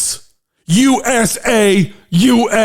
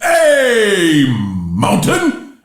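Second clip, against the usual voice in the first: drawn-out yell; much slower than usual. Shown as a demonstration. Host retrying his beer toast as a long slow yell. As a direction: bellow it like a toast. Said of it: "Let me try that again".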